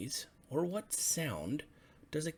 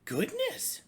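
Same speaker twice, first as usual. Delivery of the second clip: high, squeaky frog voice, startled; surprised frogs; 5 dB louder than usual. Acted. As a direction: chirp it out like an amazed little frog. Frogs exclaim at pig in pond.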